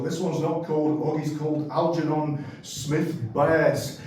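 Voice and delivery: ordinary talk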